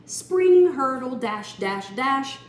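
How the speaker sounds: high, loud springing chant; spring it out energetically; bouncy action words. A narrator's reading. Narrator voicing the bunnies' leap, loud and high.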